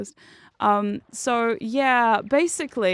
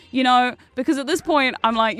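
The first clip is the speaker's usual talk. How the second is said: ordinary talk, nothing marked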